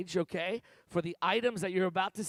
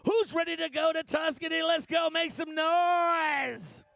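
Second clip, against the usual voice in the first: hype shout; 6 semitones higher, slower than usual. Real, not acted. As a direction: drag out the call to make noise. Auctioneer whipping up the crowd.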